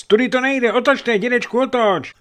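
put-on very high, loud child's warning; boy shouting. A narrator's reading. Boy warns grandfather to turn the ship, shouting. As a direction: yell it urgently in a child's high voice. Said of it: "křičel chlapec".

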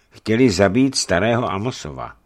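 usual speaking voice

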